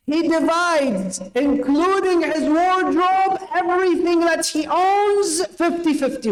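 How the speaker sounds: impassioned preaching. Acted.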